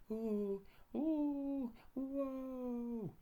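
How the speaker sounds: high, loud yelping ooh and whoa; high yelps. A narrator's reading. Saucepan man yells as he rolls over his pans. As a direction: yelp as he tumbles. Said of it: "began to yell".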